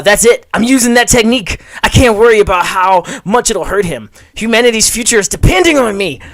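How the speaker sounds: high, loud, determined hero voice; heroic anime declaration. Acted. Reading the comic, he gives the hero a loud heroic declaration. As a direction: announce it like a shonen hero powering up. Hero resolves to use a technique.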